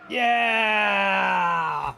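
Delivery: loud whooping yell of delight; excited shout. Real, not acted. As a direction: cheer it out at the top of your lungs. Thrilling ride in the game.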